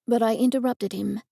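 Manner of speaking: plain reading voice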